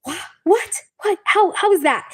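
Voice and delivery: mock-astonished questions, pitch jumping up; shocked dentist. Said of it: "and he was shocked".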